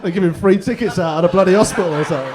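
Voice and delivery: loud, laughing aside; loud laughing joke. Real, not acted. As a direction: crack up at the crowd. Joking at crowd cheer.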